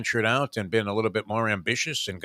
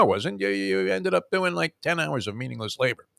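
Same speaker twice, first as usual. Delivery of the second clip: ordinary delivery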